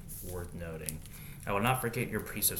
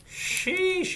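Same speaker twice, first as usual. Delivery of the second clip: surprised sheesh; 6 dB louder than usual. Real, not acted. Reacting to the verse.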